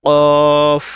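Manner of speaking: কফ said loud and deep from the throat; deep qaf. Shown as a demonstration. Teacher shows the throat-deep qaf, loud. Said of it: "কফটা একটু ভালো করে উচ্চারণ করতে হবে".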